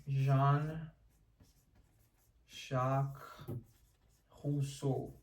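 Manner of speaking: put-on Jean-Jacques Rousseau in a light French accent; lightly French pronunciation of Rousseau; mock French accent on the name. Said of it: "I don't know why I did French".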